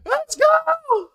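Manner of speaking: high, thrilled yell of victory; excited shout. Real, not acted. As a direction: scream it for the win. Team wins, he celebrates.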